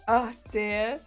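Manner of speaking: ordinary talk, nothing marked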